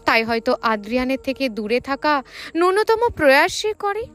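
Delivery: ordinary delivery, nothing marked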